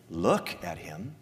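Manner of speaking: "Look at him." low, soft and slow; preacher showing what the Father does not say, quiet and slow; quiet contrast. Shown as a demonstration. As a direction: say it softly, taking the boast back. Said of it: "The Father doesn't say".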